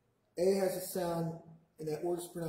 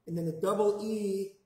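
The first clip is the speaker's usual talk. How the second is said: ordinary talk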